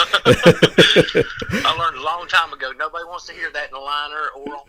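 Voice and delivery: chuckling through the words; amused, laughing aside; laughing while talking. Real, not acted. Laughing about dropping his accent.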